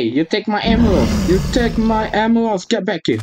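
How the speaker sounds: high, outraged gamer yelling after a thief; indignant shouting. Real, not acted. Calling out after emerald thief in game.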